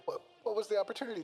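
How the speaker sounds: high, quick questioning voice; Teddy. A narrator's reading. Teddy presses Yu for an answer.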